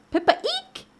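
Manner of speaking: very high little squeal on eek; mouse squeak. Acted. Mouse arriving at the hole, high squeaky voice. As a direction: squeak it like a tiny storybook mouse.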